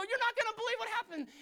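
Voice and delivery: high, loud excited yell; over-the-top excited holler; breathless news. Acted. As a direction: burst in shouting the news. Acts excited confession to God.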